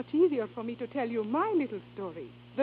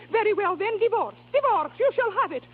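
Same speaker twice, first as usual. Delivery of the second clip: angry; 6 semitones higher, 4 dB louder than usual. Acted. Diva's furious divorce outburst.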